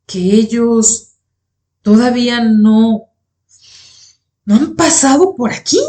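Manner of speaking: winded, incredulous asking with long pauses; panting incredulity. A narrator's reading. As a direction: stretch it out in halting pieces, disbelieving. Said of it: "una voz en donde se notaba la incredulidad".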